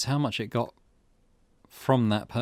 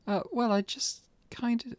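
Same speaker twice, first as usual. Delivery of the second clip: put-on higher, hesitant girl's voice; flustered girl; 13 semitones higher than usual. Girl excusing tossing the lighter, flustered.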